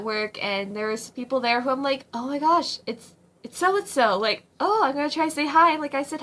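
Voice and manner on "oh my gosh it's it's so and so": ordinary delivery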